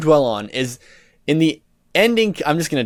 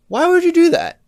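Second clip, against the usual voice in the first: shrill, much higher, louder disbelief; exasperated outburst; 14 semitones higher, 4 dB louder than usual. Real, not acted. Ranting at the game writers, high and loud exasperation. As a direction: throw the question up at the writers. Ranting about a character's death.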